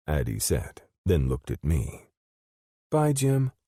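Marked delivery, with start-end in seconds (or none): 2.9-3.5 put-on high, sweet little-girl goodbye; a small child waving off a friend, pitched high. Addie saying goodbye to Jim, high little-girl voice.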